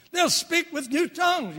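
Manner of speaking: high scripture proclamation; scripture reading. Acted. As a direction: proclaim the verse. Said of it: "quoting scripture".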